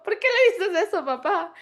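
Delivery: high, loud mock protest; protesting daughter. Daughter scolds her father over the broccoli, in high, loud protest. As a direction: pitch way up and push it loud, half laughing. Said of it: "yo como".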